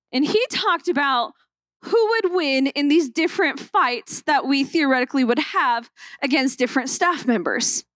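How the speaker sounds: ordinary talk, nothing marked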